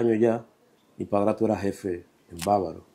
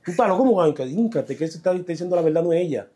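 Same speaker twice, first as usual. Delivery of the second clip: retold outraged protest; 7 semitones higher, faster than usual. Refusing the spirit's order to kneel: high excited protest. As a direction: fire back in disbelief. Arguing back at the possessed cousin.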